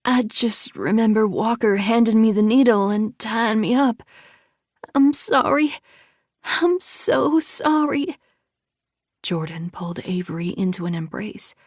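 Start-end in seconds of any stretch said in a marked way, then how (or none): 0.0-4.0 sobbing apology. A narrator's reading. Avery sobbing after assault. 4.9-5.7 Avery weeping into her sister's arms; tearful apology. A narrator's reading. 6.4-8.2 sobbing apology. A narrator's reading. Avery sobbing after assault.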